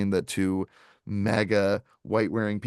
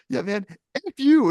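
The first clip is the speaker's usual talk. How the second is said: loud high yell of F you; mock angry shout; 13 semitones higher, 7 dB louder than usual. Woman of Mexican descent yelling at the uncle, loud mock anger. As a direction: shout it at the old man, pitched way up. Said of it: "She goes".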